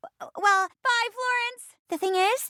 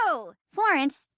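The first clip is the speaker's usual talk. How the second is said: normal talking voice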